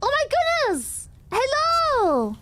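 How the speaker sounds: high-pitched, squealed welcome; excited greeting. Real, not acted. Streamer welcoming a raid, excited high squeal. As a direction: sing out hellos way up high, stretching them.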